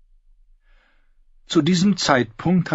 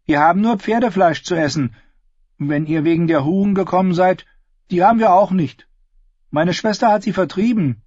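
put-on higher girl's voice, businesslike; a girl on the porch sizing up strangers, higher and guarded; wary ten-year-old Willow; 10 semitones higher, 4 dB louder than usual. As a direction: sound like a watchful child running the inn. Willow explains.

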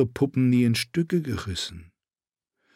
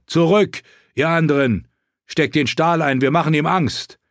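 high, raised voice giving orders; urgent command; 6 semitones higher, 4 dB louder than usual. A narrator's reading. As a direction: call the others back urgently. Jon orders armed men back. (dringlicher, lauter Befehl)

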